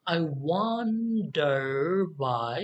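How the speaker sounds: very slow "I wonder why", stretching the stressed "won" of "wonder"; iambic stress. Shown as a demonstration. Teacher scanning the line for students, slow iambic stress on "won" and "why". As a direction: draw out "wonder", keep "I" light, stress "why". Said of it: "আইটা আমি লো ভয়েসে বলবো এবং ওয়ানটা আমি stress দেব".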